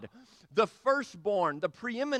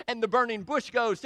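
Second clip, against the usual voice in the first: normal talking voice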